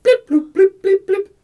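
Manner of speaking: high, short vocal blips; imitated computer beeps. Doctor works his screen, voiced beeping noises. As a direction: make little high-pitched screen noises.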